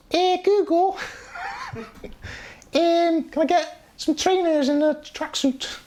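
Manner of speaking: a deliberately bad Scouse kid voice, slow; bad Scouse accent. Streamer mocks Liverpool kids asking Google, bad Scouse accent. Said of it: "That's a really bad accent".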